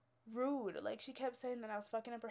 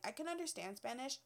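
normal talking voice

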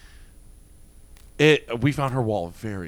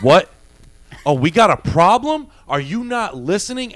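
a louder, higher, challenging retold bark; retold confrontation; 6 semitones higher, 9 dB louder than usual. Correctional officer confronting the rude woman, aggressive and loud. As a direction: square up to her like a German Shepherd. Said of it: "And she was like".

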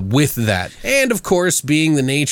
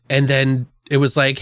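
ordinary talk

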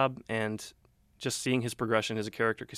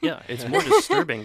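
laughing while speaking, louder; 10 dB louder than usual. Real, not acted. Cohost replying about the gore, laughing. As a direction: chuckle through the line.

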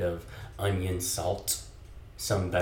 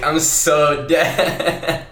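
laughing; 8 semitones higher, 9 dB louder, much slower than usual. Real, not acted. Laughing hard at friend's yawn.

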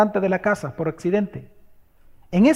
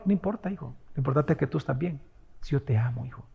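much softer, lower loving reassurance; tender comfort; 5 semitones lower, 11 dB quieter, faster than usual. Retold or imitated. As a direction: soothe the frightened child. Parent comforting the child, soft. (padre tierno que calma al hijo, en voz baja)

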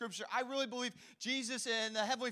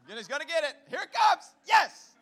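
excited cheer; 5 semitones higher, 11 dB louder than usual. Real, not acted. Cheering favourite movie moment.

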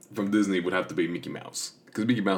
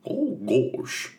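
a hint of a goofy cartoon dog; lightly voiced dopey "Oh, gosh", pitched a little up; 5 semitones higher than usual. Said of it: "he goes".

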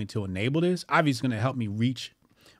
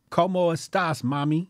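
playful Spanish line, raised in pitch; 5 semitones higher than usual. Pretend. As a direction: flirt lightly in Spanish. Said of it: "I would sound cool in Spanish".

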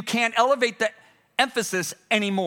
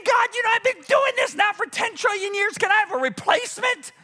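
very high, whining mock plea; mock complaining angel; 13 semitones higher than usual. Angel asking God for relief, mock whining. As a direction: whine it high, all on one pitch.

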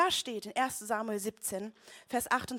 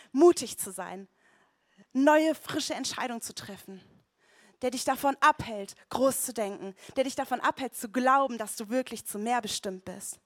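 louder, building exhortation; preacher rallying the church to courage; preaching at full force; 5 dB louder than usual. Acted. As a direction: rouse the congregation.

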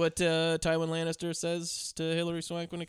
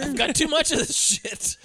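mock rich guy; 11 semitones higher, 4 dB louder than usual.

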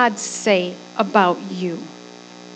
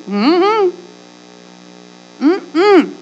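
high hummed no; wordless high "uh-uh" of denial; refusing "mm-mm"; 6 semitones higher than usual. Acted. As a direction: hum a firm no, pitched up. God's answer acted out.

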